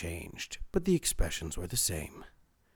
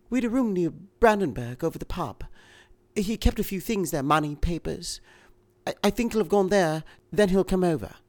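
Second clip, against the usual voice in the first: higher, anxious woman's voice; a frightened wife, urgent; 10 semitones higher, 7 dB louder than usual. A narrator's reading. Woman character in reading.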